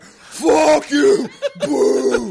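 high, loud angry heckling; imitated crowd shouting and booing. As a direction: yell it like a hostile crowd. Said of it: "the whole crowd is like".

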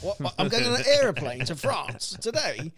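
put-on high, loud astonished child's voice; shocked kid. Dad imitating his son's shock at a surprise trip, loud and high. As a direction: gasp it out like a stunned toddler.

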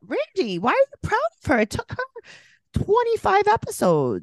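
high, squeaky mock question to Randy; mocking falsetto aimed at the TV.

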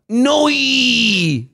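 broad Aussie "Noi"; Australian accent. Shown as a demonstration. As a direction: stretch it into "Noi". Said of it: "my Australian accent".

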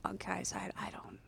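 breathy unvoiced whisper. Real, not acted. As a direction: whisper back, hushed. Whispered reply to burp aside.

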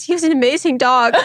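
teary echo. Retold or imitated. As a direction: repeat it as if about to cry. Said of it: "I was".

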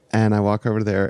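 usual speaking voice

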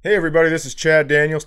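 ordinary talk, nothing marked

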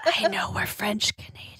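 breathy laughing whisper. Pretend. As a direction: whisper it into a dying ear. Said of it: "whisper it in her ear".

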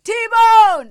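high, loud holler; shouted call-out. Retold or imitated. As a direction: call it out across a street. Fans calling out in public.

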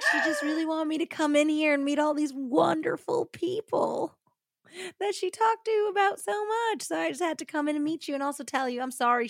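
high, gushing mock politeness; fake sweet twin. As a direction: gush like a phony newcomer. Acts fake twin greeting strangers.

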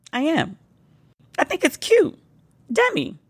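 cutesy self-defence; playful defence. Retold or imitated. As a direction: sing the name sweetly. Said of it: "defends her name".

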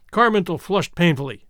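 usual speaking voice

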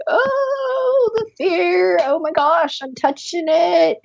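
startled excitement. Retold or imitated. Coach retells her own jolt of fear to the group: high, mock-startled exclaim. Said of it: "I felt, like, energy in my feet".

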